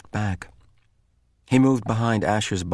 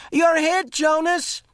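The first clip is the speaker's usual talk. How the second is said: yelled; slower than usual. A narrator's reading. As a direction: call the hit from behind a tree. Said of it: "Asher yelled".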